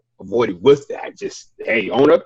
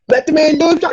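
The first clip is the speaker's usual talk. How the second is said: higher, hammed-up scolding voice; mock scold; 3 dB louder, faster than usual. Telling the driver off in a hammed-up high voice. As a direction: play it as a put-on scold.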